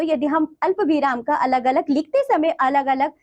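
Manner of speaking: ordinary talk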